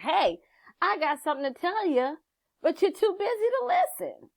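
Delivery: a lightly voiced inner spirit urging you to listen; inner voice of intuition. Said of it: "your intuition knocking, like".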